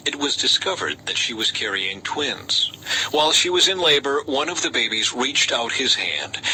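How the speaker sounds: usual speaking voice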